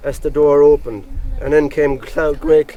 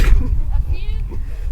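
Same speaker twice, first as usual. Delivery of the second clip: high jeering cackle; high mocking laugh; 5 dB quieter than usual. Crowd laughing at Grey Clothes, high jeering laugh.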